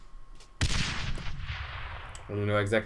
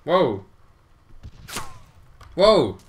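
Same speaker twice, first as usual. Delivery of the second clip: loud, high exclamation; startled whoa; 5 semitones higher, 8 dB louder than usual. Real, not acted. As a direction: cry out in sudden surprise. Reacting to game action.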